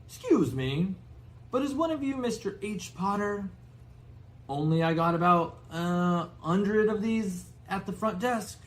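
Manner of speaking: high, loud landlady voice; high-pitched hotel landlady asking. Acted. Hotel owner voiced in story.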